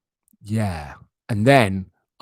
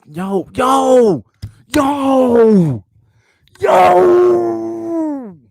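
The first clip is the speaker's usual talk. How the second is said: calling out; 13 semitones higher, 7 dB louder, much slower than usual. Real, not acted. Calling out at cat.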